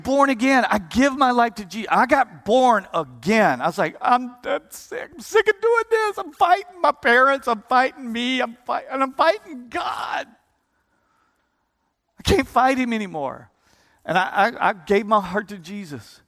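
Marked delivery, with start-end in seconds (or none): 4.0-13.5 put-on high anguished shout of a young man at the end of his rope; retold anguished shout from the pew; desperate outcry. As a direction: cry it out, pitch soaring. Retelling his desperate cry.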